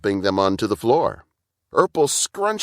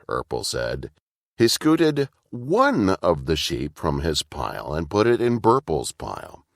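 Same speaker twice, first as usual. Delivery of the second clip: ordinary delivery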